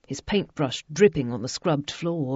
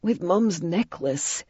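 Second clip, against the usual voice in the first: 1 in anguish; slower than usual. A narrator's reading. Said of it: "Jamie said in anguish".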